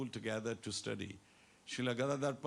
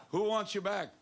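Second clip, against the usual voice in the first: louder prompting question; 5 semitones higher, 5 dB louder than usual. Shown as a demonstration. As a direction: ask it louder, drawing the answer. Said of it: "aap to itna dheema bolte na".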